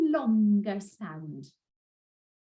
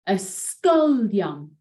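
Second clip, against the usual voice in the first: ysgolion slow, stress on the penultimate syllable "gol"; much slower than usual. Shown as a demonstration. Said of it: "stress usually falls on the last but one".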